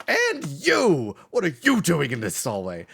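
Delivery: high, loud hall-monitor bark. Acted. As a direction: demand it in that squeaky monitor voice. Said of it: "and he goes".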